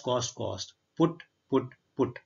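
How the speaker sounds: normal talking voice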